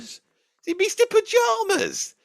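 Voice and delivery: high, slow, drawn-out disbelief; Mister Pajamas asked very high and slow, mocking the name; incredulous query. Questioning the odd name.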